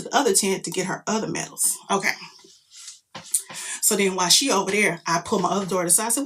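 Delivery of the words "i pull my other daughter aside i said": ordinary delivery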